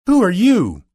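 high, loud startled question; crying out. A narrator's reading. Dorothy confronting the little old man, crying out. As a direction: cry it out, pitched well up. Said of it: "Dorothy cried out".

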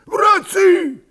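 very high, loud hailing shout; calling out. A narrator's reading. Cossack hails his brothers over the din, shouting. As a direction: grab the crowd's attention with one yell. Calling the noisy crowd to attention.

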